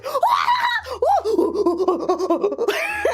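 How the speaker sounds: loud laughing screech, half crying; laughing scream. Retold or imitated. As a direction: scream and laugh at the departing car. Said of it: "Z starts going".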